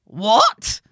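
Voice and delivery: loud, rising outraged cry; alarmed, furious shock. A narrator's reading. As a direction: burst out in alarm, pitch rising. Mother's alarm and fury.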